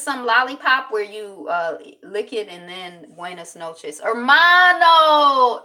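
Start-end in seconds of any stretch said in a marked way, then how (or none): 4.0-5.6 loud, high, long-drawn word; playful mock-Spanish call. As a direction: belt it out playfully, stretching the vowels. Playing at speaking Spanish.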